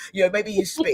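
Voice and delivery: normal talking voice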